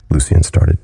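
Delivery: plain reading voice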